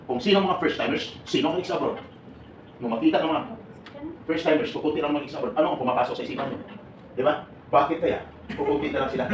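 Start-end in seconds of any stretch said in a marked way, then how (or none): none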